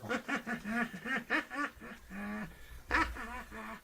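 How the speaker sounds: shrill dummy laugh; high puppet cackle. Acted.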